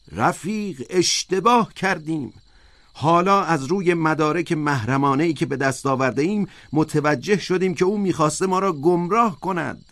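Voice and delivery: high, insistent orator's voice; high-voiced persuader correcting a comrade; Squealer, insistent. A narrator's reading. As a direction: pitch it high and press the point.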